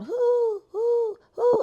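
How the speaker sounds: very high, loud hooting "who"; imitating the owl outside the house, high and loud; owl hoot imitation. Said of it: "it kept going on".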